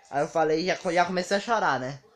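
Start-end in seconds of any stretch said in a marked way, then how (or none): none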